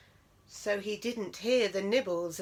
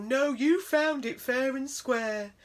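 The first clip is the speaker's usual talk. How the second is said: reassuring mouse; 5 semitones higher, 5 dB louder, slower than usual. A narrator's reading.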